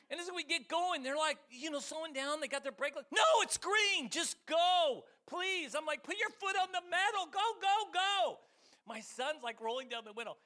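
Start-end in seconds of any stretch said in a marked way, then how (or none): 3.1-5.8 high, loud pleading at traffic; retold frustrated yelling. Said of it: "I'm yelling to make the light". 6.0-8.4 high, loud frantic driver; retold urgent yelling. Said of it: "I'm yelling to make the light".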